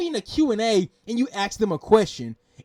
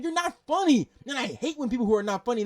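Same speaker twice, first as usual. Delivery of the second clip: usual speaking voice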